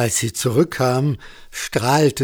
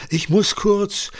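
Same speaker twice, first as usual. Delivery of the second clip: high, flustered excuse; mild panic, pitched up; flustered stammer; 10 semitones higher, 3 dB louder than usual. A narrator's reading. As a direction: break off as if desperate to get away. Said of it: "stammelte er".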